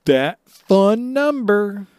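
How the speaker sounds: slow, high knowing tease; teasing. Pretend. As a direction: drag it out with a smirk. Teasing echo.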